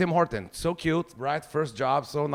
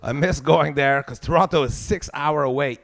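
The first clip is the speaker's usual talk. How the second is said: ordinary talk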